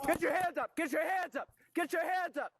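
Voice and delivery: urgent high police yell; shouting commands. Real, not acted. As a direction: bark orders at full force. Police confronting armed suspect.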